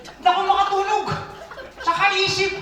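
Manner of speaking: fast, high laughing confession. Real, not acted. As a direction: laugh off the sleepless worry. Laughing about sleepless worry.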